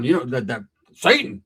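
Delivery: ordinary talk, nothing marked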